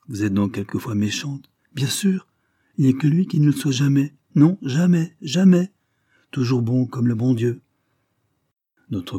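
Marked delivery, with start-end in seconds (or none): 1.7-7.6 put-on high, louder child's insistence; emphatic little girl. A narrator's reading. Little girl defends her friend to visitor, emphatic and loyal. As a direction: protest loyally, rising in pitch and force.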